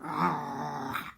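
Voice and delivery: put-on a playful spooky laugh to frighten the kids; scary dragon laugh. Voicing the frightening dragon for children, mock scary laugh. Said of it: "Did I scare you?".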